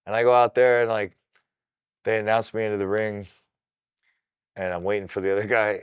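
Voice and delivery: ordinary talk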